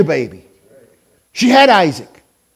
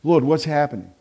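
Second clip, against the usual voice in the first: low, soft appeal to the Lord; hushed prayer; 7 semitones lower, 6 dB quieter than usual. Acted. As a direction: drop to a subdued, private plea. Same appeal, now hushed after pause.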